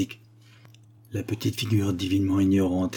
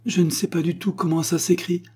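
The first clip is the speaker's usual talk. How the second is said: put-on higher, piteous little-girl voice; piteous child; 9 semitones higher than usual. A narrator's reading. Girl taking dictation from her father, piteous. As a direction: plead helplessly in a child's high pitch. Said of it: "fit-elle piteusement".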